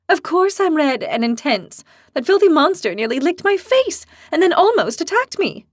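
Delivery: high, loud, indignant shouting; angry outburst. Acted. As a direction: vent the fury at the monster. Lily flares up angrily.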